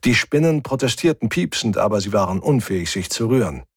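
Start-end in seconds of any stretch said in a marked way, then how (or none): none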